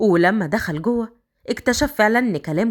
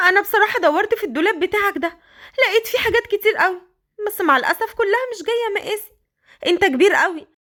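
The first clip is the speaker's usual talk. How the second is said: put-on higher, young girl's voice; Qadr, high girlish voice; 9 semitones higher, faster than usual. The girl complaining to Raad his clothes don't fit, high girlish voice.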